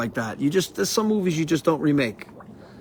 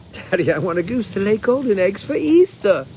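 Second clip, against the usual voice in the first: put-on higher, whiny little-girl demand to daddy; spoiled girl demanding; 5 semitones higher than usual. Quoting the spoiled girl begging daddy, mock whiny. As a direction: pout it like a bratty rich kid.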